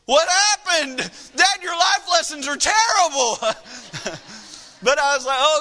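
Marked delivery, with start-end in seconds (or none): 0.0-3.4 put-on higher and louder, an indignant little girl's cry; upset daughter. Daughter scolds dad over the lost fruit chews, shrill indignant shout. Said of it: "Bailey's standing there ... saying". 3.4-4.6 soft laugh. Real, not acted. Laughs at own story.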